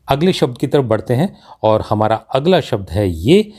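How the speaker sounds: ordinary talk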